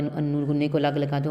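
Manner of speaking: ordinary talk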